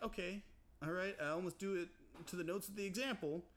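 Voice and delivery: high, agitated retold rant; mock exasperation. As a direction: pile on the quiz's accusations.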